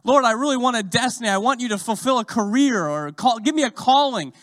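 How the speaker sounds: hammed-up begging for a destiny; whiny petitioner. Churchgoer whining to the Lord for a calling. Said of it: "Some people are like".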